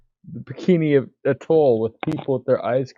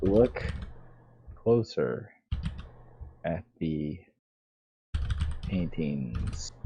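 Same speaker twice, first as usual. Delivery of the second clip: much softer, slow, with long pauses; hushed ominous hint; 7 semitones lower, 10 dB quieter, slower than usual. Acted. Player hinting at the sinister paintings, hushed and slow. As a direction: drop to a creepy near-hush, word by word.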